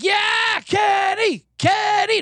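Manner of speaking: high shouted cheer of a casino crowd. Retold or imitated. Crowd at the table cheering Kenny, shouted. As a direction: yell his name like the cheering crowd. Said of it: "Everyone's like".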